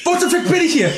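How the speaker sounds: high, incredulous yell; exasperated outburst. Acted. Prisoner bewildered by cellmate, exasperated outburst. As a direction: burst out in hammed-up outrage. Player's character exasperated in jail.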